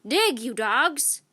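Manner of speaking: captain ordering his crew; cried. A narrator's reading. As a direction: drag out the order, loud. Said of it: "cried Captain Bones".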